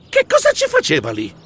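high startled question; shocked. Acted. Mouse spotting Lili in the bushes, high shocked question. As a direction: gasp it out in disbelief.

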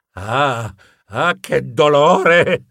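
drawn-out cry of pain; pained groan. Acted. Hero after slamming his snout into the wall, pained. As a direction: groan slowly through the hurt.

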